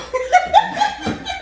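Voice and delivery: shrieking laugh; helpless high-pitched laughter; high cackling laugh. Real, not acted. Burst of laughter.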